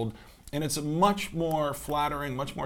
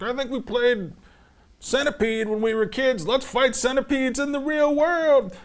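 mocking; 9 semitones higher, 7 dB louder, slower than usual. Mocks clueless filmmakers' thinking.

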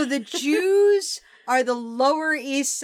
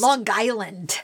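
posh Long Island; 3 semitones lower than usual. Pretend. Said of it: "posh Brits up on, uh, Long Island".

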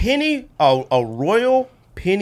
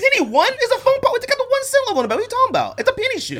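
high-pitched, rapid-fire arguing; incredulous protest; faster than usual. Real, not acted. Arguing with podcast co-hosts over the Penny shoe line, high-pitched protest. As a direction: argue it in a high, disbelieving voice.

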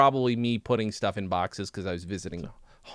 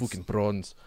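softer, lower mock South African accent; 5 semitones lower, 4 dB quieter, slower than usual. Host imitating grumbling South African coworkers, attempted South African accent. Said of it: "only thing I can almost sort of say in a South African accent".

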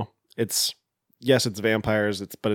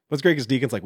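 ordinary delivery

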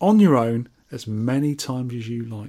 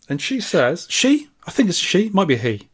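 usual speaking voice

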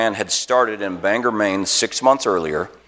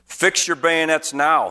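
higher, slow, firm military order; commanding; 7 semitones higher, slower than usual. Acted. Colonel orders his stunned men, slow and high. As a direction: bark it out slow to frozen troops. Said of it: "he commanded".

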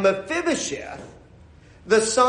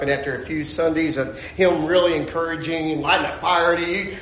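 ordinary talk

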